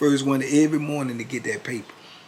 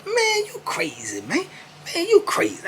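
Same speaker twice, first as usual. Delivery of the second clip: Keith denying; 4 dB louder than usual. Retold or imitated. Keith denying he can't read, high and scoffing. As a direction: scoff it off in a squeaky voice.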